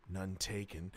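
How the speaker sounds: low, quiet deadpan; muttered low dry retort; deadpan none taken. Retold or imitated. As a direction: drop it low and flat like a wounded soldier. Hicks quoted, visibly insulted.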